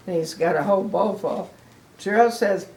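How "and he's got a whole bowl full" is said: plain reading voice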